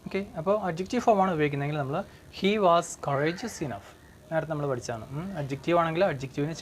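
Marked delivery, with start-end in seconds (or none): 2.4-3.9 slow model sentence. Shown as a demonstration. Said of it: "teacher reads model sentence".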